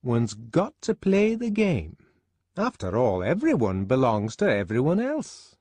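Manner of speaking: lofty, high-pitched sententiousness; sententious. A narrator's reading. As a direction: recite it like a pious maxim. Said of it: "she said sententiously".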